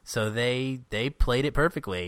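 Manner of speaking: usual speaking voice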